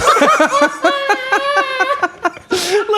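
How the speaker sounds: bursting into a loud high laugh. Real, not acted. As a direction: laugh out loud at your own punchline.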